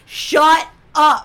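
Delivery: loud, drawn-out mock snap; pissed-off husband. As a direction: imitate an exasperated husband, each word loud and separate. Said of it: "John is pissed".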